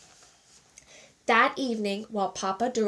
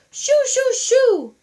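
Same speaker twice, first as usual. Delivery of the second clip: high, loud shoo; shooing cry; 13 semitones higher, 8 dB louder, slower than usual. A narrator's reading. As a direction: chase the dog off. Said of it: "the manager cried".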